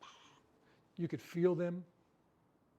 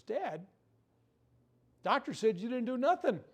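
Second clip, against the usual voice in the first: higher, louder daughter voice, mock indignant; imitating his daughter; 8 semitones higher, 6 dB louder than usual. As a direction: play the daughter reporting back, pitched up and loud. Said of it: "she comes home and says".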